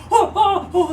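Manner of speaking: high, loud delighted gasps; a crowd oohing at fireworks; amazed crowd. Acted. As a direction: gasp in wonder at the fireworks.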